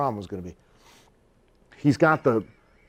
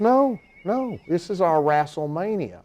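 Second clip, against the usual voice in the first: promoter's voice; 6 semitones higher, slower than usual. Retold or imitated.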